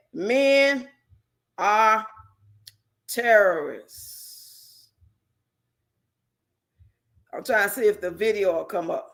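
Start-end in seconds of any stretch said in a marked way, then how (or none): 0.1-4.9 each word spaced out, "terrorists" dragged; slow, drawn-out quote. Retold or imitated. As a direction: read it like a verdict. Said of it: "you say".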